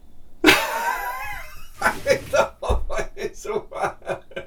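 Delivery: shrieking laugh. Real, not acted. Audience reaction shout-laugh.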